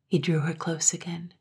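ordinary delivery, nothing marked

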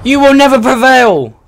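high, loud hammed-up villain taunt; mock battle cry. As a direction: bellow it like a cartoon hero.

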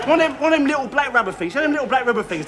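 usual speaking voice

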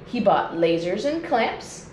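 ordinary delivery

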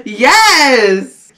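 voiced, laughing exclamation; happy full-voice yes; excited yes. Real, not acted. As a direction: cheer it out with a laugh. Excited yes, then whispered repeat.

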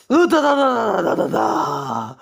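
put-on high, sobbing child babble; frightened high crying nonsense. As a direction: sob out the syllables like a scared child.

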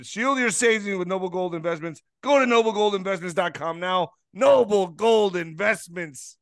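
punchy commercial voice; ad read. Acted. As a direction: sell it like a commercial. Retaken ad read.

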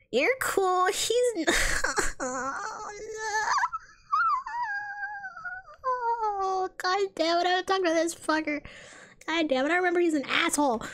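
high, strained groaning dismay; exasperated groan. Real, not acted. Player groans on spotting a hated character, exasperated. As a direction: whine in dread at who just turned up.

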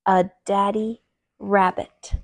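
ordinary delivery, nothing marked

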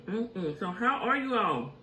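ordinary talk, nothing marked

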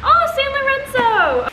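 high, loud, drawn-out delighted cry; thrilled to see who sent it; loud happy gasp of recognition; excited squeal. Real, not acted. Excited opening a package.

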